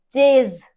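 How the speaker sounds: चेज with a long buzzing z; slow, drawn-out z at the end of चेज; teacher stretching the z in चेज. Shown as a demonstration. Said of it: "चेज ज आया".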